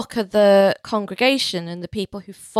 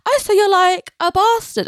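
mocking kid; 10 semitones higher than usual. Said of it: "I had more than one other kid say".

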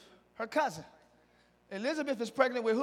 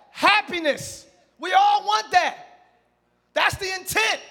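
preaching; 6 semitones higher, 6 dB louder than usual. Acted. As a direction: preach it out big to the room. Preaching opener to congregation.

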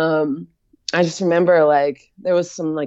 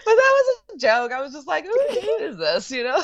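high laughing disbelief; 10 semitones higher than usual. Real, not acted. Joking about the vision, high laughing disbelief. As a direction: laugh through the question.

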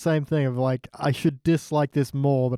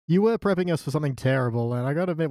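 ordinary delivery

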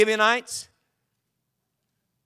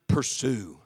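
voice of God; 8 semitones lower, 6 dB quieter than usual. Acted. God answers David in scripture.

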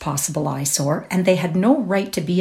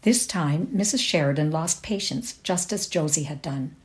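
ordinary delivery, nothing marked

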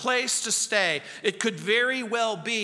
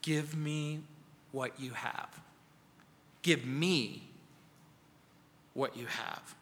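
soft, gentle command; 5 semitones lower, 9 dB quieter than usual. Retold or imitated. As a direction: lower and much softer, tender Jesus voice. Jesus' words voiced in sermon.

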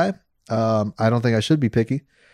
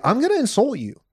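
higher, lightly put-on voice imitating a dater; mock profile voice; 10 semitones higher than usual. Podcaster mimicking dating profiles to co-host, high mocking voice. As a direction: voice the dater's warning, pitched up.

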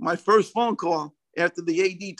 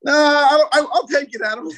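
high, loud mock-frantic outburst; hammed-up blurting; 9 semitones higher, 7 dB louder than usual. Coach re-enacting his change of mind to the referee, frantic blurting. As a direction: blurt it out loudly, pitched way up.

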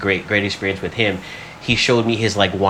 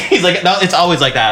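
higher and laughing; laughing quote; 7 semitones higher, 4 dB louder than usual. Real, not acted. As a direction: quote him while still laughing. Laughing while quoting the guest.

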